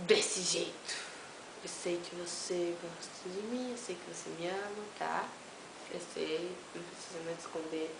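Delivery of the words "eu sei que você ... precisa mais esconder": teasing murmur. Pretend. Provocação baixinha.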